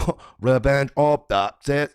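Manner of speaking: slow, loud, hammed-up sounding-out of the name; mock puzzlement. Streamer imitating Jeremy struggling with the show's name, slow mock puzzlement. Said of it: "But he's like".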